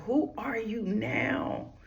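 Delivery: slow whispered question; hushed whisper. Acted. Speaker to grieving listeners, a slow whisper. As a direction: breathe the question out softly.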